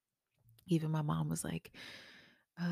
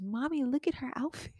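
put-on toddler voice; 10 semitones higher than usual. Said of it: "she always says".

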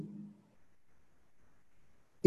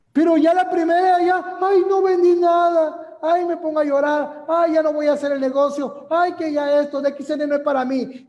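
higher, louder, whimpering self-pity; mock whiny crying; 6 semitones higher, 3 dB louder than usual. Mocking a beginner who sells nothing and wants to quit, hammed-up whining. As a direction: play the newbie who wants to cry and quit. Mocking a discouraged new seller.

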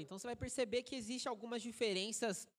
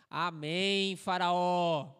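pastor calling out; 3 semitones lower, 9 dB louder, much slower than usual. Retold or imitated. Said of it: "começa a falar assim pra ele do banco".

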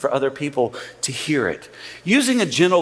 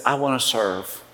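softer, lower, humble offer; a lightly voiced wish to help; quiet servant tone; gentle serving tone; 6 semitones lower, 3 dB quieter than usual. Shown as a demonstration. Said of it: "tone that says".

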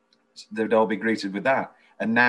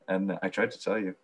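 ordinary talk, nothing marked

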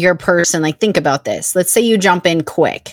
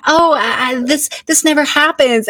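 high, stammering feigned innocence; pitched-up innocent act; mock coy; 7 semitones higher than usual. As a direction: stumble coyly through a mock denial. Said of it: "like, coy".

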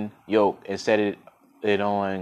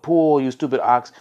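higher-pitched crowd yell, only a little louder; mildly raised yell at a stubborn ox; yelled jeer; 4 semitones higher, 3 dB louder than usual. A narrator's reading. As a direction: call out like a heckler in the crowd. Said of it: "They yelled".

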